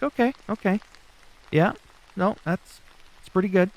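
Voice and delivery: impressed okay. Retold or imitated. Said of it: "I was like".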